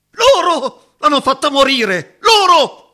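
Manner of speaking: high, loud accusing yell; furious shout. Acted. Roberto shouting at the parents who let Alice die. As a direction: accuse them with all your rage.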